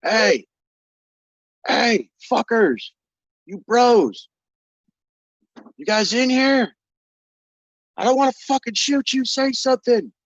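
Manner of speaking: high, loud wary calling into the room. Acted. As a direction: call out nervously, not wanting to shoot anyone. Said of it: "so now you guys are hearing like".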